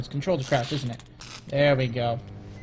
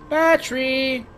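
high, drawn-out "Battery!"; calling out; 9 semitones higher, slower than usual. Pretend. Player calling for a battery, calling out. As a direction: call it high, then let it fall.

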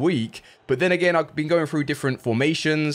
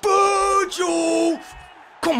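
high, long drawn-out shout; excited shout; much slower than usual. Real, not acted. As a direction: cheer the name out. Cheering a header.

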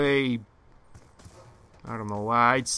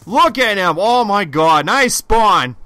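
raging gamer; 8 semitones higher, 11 dB louder, slower than usual. Real, not acted.

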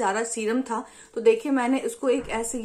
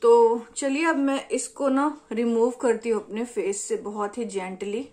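talking through face mask; wearing a sheet mask. Real, not acted. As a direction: lips framed by mask. Seen 2: Wet sheet mask covers face throughout; mouth hole leaves lips free.

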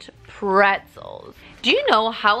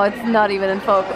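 ordinary talk

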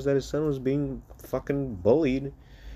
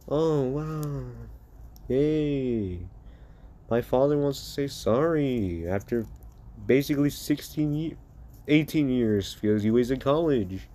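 sarcastic. Acted. Son mocking his father's late apology, sarcastic. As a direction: pretend to be impressed, dripping sarcasm. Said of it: "more sarcastic, evil person".

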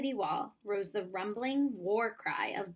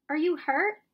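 worried question; 6 semitones higher, 8 dB louder than usual. A narrator's reading. Baloo asks Mowgli, worried.

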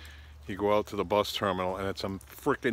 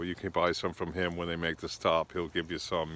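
usual speaking voice

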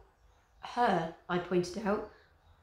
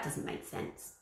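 whispered; 10 dB quieter, slower than usual. Acted. Flavia puzzled aside.

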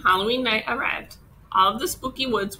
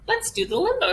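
put-on excited little girl; 12 semitones higher than usual. May proposes limbo, excited.

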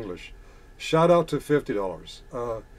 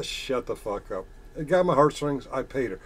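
normal talking voice

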